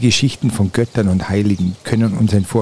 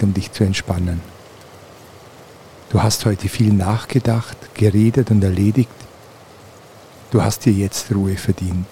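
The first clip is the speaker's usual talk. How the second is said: guided meditation. Acted. Leads a guided relaxation.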